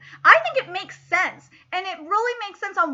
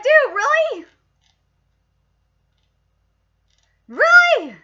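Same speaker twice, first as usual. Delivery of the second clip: high, louder mock disbelief; incredulous; 10 semitones higher, 8 dB louder, slower than usual. Imitating Orca scolding the reckless student, incredulous. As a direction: ask like you can't believe him. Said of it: "Orca's like".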